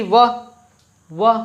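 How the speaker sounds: व sounded alone, lip against teeth; व sound demo. Shown as a demonstration. Teacher models the व sound for students to copy. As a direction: give a clean isolated व from teeth and lip. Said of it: "व बोल के देखिए".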